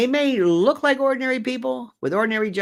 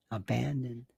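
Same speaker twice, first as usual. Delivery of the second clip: softer, hushed abandoned; whispered; 7 semitones lower, 12 dB quieter than usual. Shown as a demonstration. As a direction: drop to a near whisper on abandoned. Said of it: "I'm whispering, abandoned".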